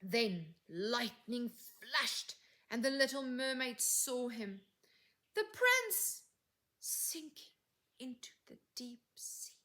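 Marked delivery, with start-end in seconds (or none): none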